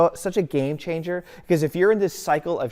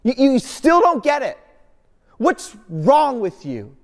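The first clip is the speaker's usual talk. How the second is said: high, louder, fed-up scold; disgusted exasperation; 9 semitones higher, 6 dB louder than usual. Pretend. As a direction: snap at someone who still doesn't get it. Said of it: "doesn't look at you with disgust and say".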